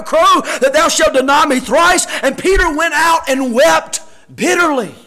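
impassioned pulpit voice; full-force preaching. Real, not acted. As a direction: preach the verses hard to the congregation. Preaches and reads scripture forcefully.

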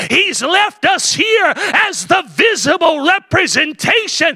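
high, ringing preacher's cry; preaching at full force. Acted. Pastor lifting the congregation, preaching at full force. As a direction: lift it up like a revival preacher.

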